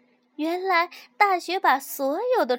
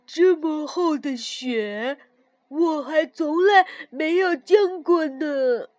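marvelling; 6 dB louder, slower than usual. A narrator's reading. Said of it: "跳跳惊叹道".

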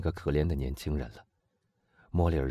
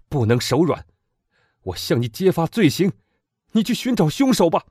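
rising louder and higher, more and more worked up; growing agitation; 11 semitones higher, 8 dB louder than usual. Acted. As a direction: let the agitation build line by line. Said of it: "莫雷尔越说越激动". (越说越激动)

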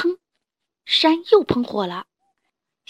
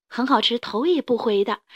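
ordinary delivery

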